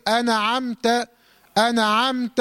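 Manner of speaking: أنعمت said "an-a-amta"; wrongly voweled nun. Shown as a demonstration. Teacher warns against moving the letter, extra vowel in أنعمت. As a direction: slip a short vowel after the nun in أنعمت. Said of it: "ولا تحرك وتقول لي".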